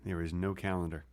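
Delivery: low, hushed correction; quiet insistence. Real, not acted. Correcting his co-host about the calendar, quiet insistence.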